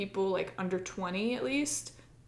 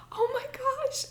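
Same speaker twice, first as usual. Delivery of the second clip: stunned delight; slower than usual. Retold or imitated. Stunned relief at getting tickets.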